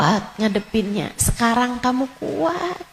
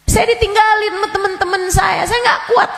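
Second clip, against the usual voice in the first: rushed, pitched-up lament; high, fast wailing complaint; 11 semitones higher, faster than usual. Acted. An abandoned congregant to the ustazah, high and wailing. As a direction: cry it out fast.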